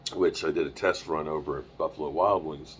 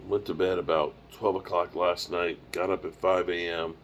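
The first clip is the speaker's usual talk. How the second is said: ordinary talk, nothing marked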